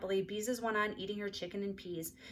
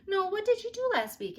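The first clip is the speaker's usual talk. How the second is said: 1 aunt; 12 semitones higher, 6 dB louder than usual. A narrator's reading. Aunt asks again after interruption.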